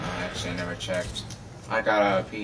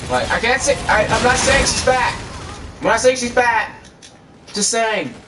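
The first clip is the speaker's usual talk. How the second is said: high, louder insistence; defensive protest; 11 semitones higher, 4 dB louder than usual. Real, not acted. Vlogger defending himself over calling girlfriend heavy, defensive. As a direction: protest in a raised, high pitch.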